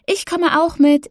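put-on high eager child voice, stress on mit; a small child insisting, mit falling; little girl pleading. A narrator's reading. Little sister begs to come.

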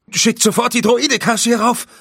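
high, loud cry of panic; viceroy yelling in panic; panicked shout. A narrator's reading. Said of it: "rief er".

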